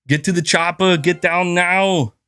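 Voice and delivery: slow, hammed-up Austrian accent; Arnold impression. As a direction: drag out the Austrian movie lines. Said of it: "trying to mimic them".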